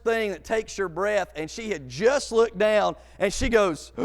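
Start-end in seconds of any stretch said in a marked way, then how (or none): none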